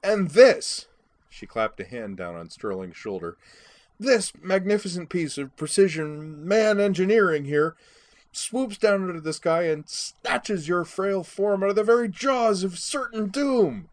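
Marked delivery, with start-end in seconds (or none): 0.0-0.9 loud, high-pitched tipsy patter; rowdy, tipsy storytelling; drunken boasting. A narrator's reading. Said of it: "drunken Starbuck". 4.0-13.9 loud, high announcer-style hype, slowing to the finish; booming mock-epic drunken tribute, drawn out at the end; drunken grandiose boasting. A narrator's reading. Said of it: "drunken Starbuck".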